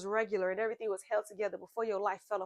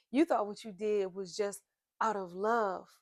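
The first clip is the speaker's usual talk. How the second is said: ordinary talk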